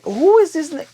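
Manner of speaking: high, sharp mock outrage; outraged disbelief. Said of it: "Jace is like".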